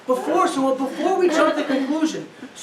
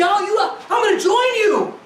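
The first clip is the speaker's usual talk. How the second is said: mock joining in; 7 semitones higher than usual. Spouse mockingly joins the anger.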